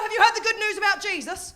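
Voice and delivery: high, chirpy preacher at the door; imitated doorstep evangelist. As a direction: pitch it up, eager to convert.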